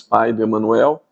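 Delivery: normal talking voice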